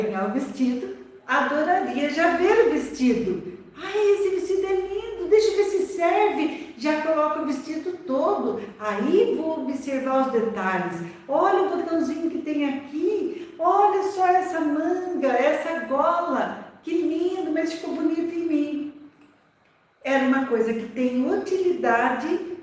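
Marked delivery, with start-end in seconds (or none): 3.8-6.7 put-on higher, excited little girl trying on a dress; delighted child. Child thrilled by her new dress, higher and excited. 11.3-18.9 put-on higher, delighted child pointing out details; admiring child. Girl admiring her whole dress on herself, higher and delighted.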